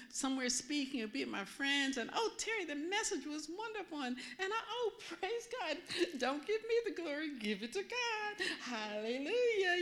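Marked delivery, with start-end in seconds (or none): 2.1-5.8 high, sugary churchy gush with a laugh; mock pious gushing. Preacher mocking church praise and her own humble reply, high and gushing. 6.2-9.7 high, sing-song false modesty, slowing on hallelujah; mock humble piety. Preacher mocking her own false modesty after church, high and sugary.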